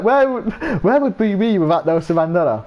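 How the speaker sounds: high, wondering, silly voice; mock dim friend. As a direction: ask it like a clueless kid. Retold character's silly question.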